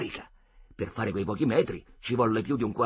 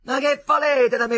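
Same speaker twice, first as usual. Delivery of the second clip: loud, high, exasperated shout; angry yell; 13 semitones higher, 8 dB louder than usual. Acted. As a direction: yell back at the mocking kids. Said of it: "col fiatone, gli urlò". (urlo esasperato)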